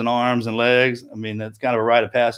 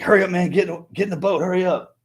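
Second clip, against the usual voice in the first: higher-pitched, hurried-sounding command; imitated urging; 6 semitones higher than usual. Instructor hurrying a swimmer into the boat, imitated urging. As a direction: urge him along, as a retold order.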